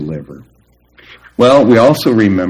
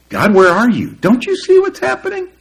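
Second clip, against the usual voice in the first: high, pleading question to heaven; pleading cry to God. Retold or imitated. Believer in trouble crying out to God, high and pleading. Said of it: "It's tempting to ask".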